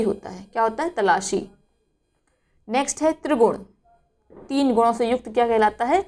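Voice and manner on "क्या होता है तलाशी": ordinary delivery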